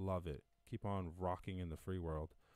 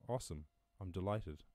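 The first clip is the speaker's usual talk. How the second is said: ordinary delivery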